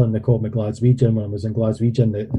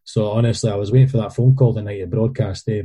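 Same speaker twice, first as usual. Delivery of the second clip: normal talking voice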